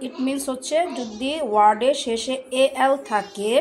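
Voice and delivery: ordinary talk, nothing marked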